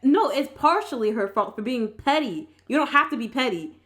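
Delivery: ordinary delivery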